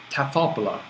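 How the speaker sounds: "tathopola" run together with no break; as one word. Shown as a demonstration. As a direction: join te and hopla into a single "tathopola". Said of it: "transliteration singular as one word".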